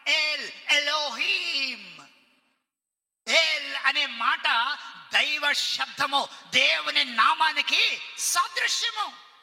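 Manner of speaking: loud, slow, emphatic preaching; preaching at full force. Acted. Preacher proclaiming God's names to the congregation, loud and slow.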